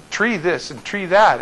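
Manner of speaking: "tree" for three, with a hard t in place of th; slow "Tree this and tree that", plain t for th; Belizean th as t. Retold or imitated. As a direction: imitate a friend saying "Tree" with a t for th. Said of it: "They can't pronounce the, the th".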